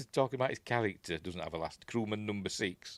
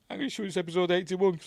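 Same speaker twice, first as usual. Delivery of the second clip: higher, louder, fast eager blurt; excited Guy; 10 semitones higher, 6 dB louder, faster than usual. Retold or imitated. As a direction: rattle it off in a nervous rush. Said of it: "And Guy's saying".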